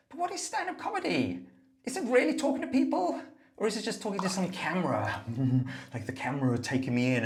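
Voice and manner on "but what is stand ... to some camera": much higher, louder mock-earnest questioning. Comic mimics a comedian questioning stand-up to camera. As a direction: squeak it out like a baffled comic. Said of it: "And it's like".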